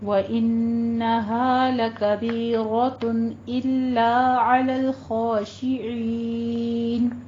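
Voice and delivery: slow melodic tajweed recitation; Quran recitation. Shown as a demonstration. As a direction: intone the verse slowly and evenly. Teacher recites verse 45.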